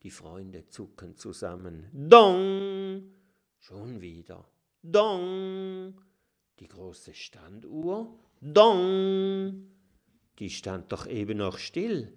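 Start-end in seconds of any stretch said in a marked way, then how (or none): 1.9-3.1 high ringing DONG; booming clock chime. A narrator's reading. 4.8-5.9 high ringing DONG; booming clock chime. A narrator's reading. 8.4-9.7 high ringing DONG; booming clock chime. A narrator's reading.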